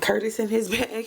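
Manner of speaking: ordinary delivery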